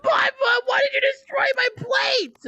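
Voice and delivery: high, loud furious yell; angry shout. Acted. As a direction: yell at him for smashing the plate. Angry confrontation over broken plate.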